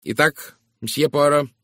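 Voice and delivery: impatient. A narrator's reading. Busy woman to the detective who called her aside, impatient. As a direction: press him to get on with it. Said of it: "нетерпеливо сказала мисс Говард".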